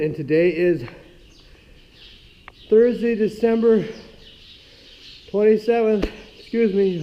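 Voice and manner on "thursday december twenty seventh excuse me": out of breath. Real, not acted. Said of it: "I'm out of breath from trudging through that snow".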